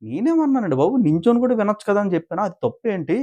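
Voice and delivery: high-pitched indignant imitation; acting out her huffy comeback; mock angry protest. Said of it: "ఈవిడే కోప్పడుతుందంటే".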